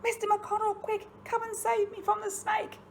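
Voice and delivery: mock fright.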